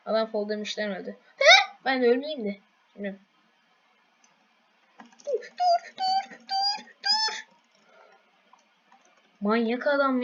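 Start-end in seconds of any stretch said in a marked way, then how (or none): 1.4-1.8 startled huh. Real, not acted. Sudden confusion in game. 5.3-7.3 very high, frantic cries of stop; panicked pleading. Real, not acted. Gamer shrieking at an attacking player to stop.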